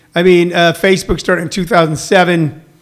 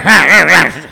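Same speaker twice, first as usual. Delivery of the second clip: loud laugh; 2 semitones lower, 3 dB louder than usual. Real, not acted. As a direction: burst out laughing after the mock put-down. Laughs after mocking the neighbor.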